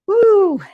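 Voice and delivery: high loud cheering whoop; excited whoop. Real, not acted. Trainer whooping to pump up the class. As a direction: let out a big whoop of effort.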